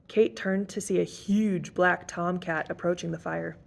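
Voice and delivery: ordinary delivery, nothing marked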